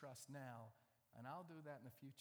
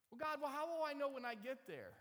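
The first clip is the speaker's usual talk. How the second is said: high, loud, pressing question; anxious questioner; 10 semitones higher, 8 dB louder than usual. Acted. Abram questioning God about the land, high and insistent.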